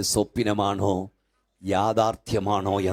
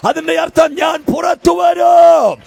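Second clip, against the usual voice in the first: full-voiced shout, much higher and louder; shouted declaration; 14 dB louder than usual. Preacher leading the crowd in a shouted vow. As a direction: bellow it out like a battle cry.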